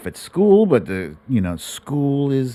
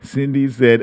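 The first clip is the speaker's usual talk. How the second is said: normal talking voice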